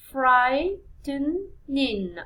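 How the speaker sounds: stretched out very slowly, syllable by syllable; slow, drawn-out syllable count; very slow drawn-out word. Shown as a demonstration. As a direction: draw the word out for students to count. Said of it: "So it's three syllables".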